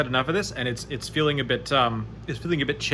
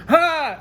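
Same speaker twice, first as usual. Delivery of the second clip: high, loud startled yelp; startled cry; 12 semitones higher, 5 dB louder than usual. Acted. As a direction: cry out as the dog bites. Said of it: "she puts her arm up like".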